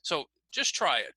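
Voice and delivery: ordinary talk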